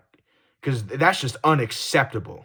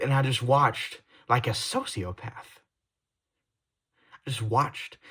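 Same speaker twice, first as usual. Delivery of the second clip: normal talking voice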